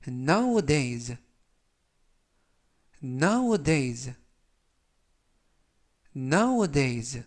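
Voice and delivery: nowadays drawn out very slowly and clearly; 아주 천천히 nowadays 발음 시범; slow demo pronunciation. Shown as a demonstration. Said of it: "라고 발음하시면 되겠습니다". (길게 늘여 또박또박 nowadays)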